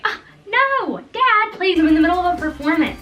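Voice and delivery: put-on high, pleading, loud kid protest; whiny tipsy protest. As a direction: beg Dad to let you finish the show. Tipsy kid refuses giving drink.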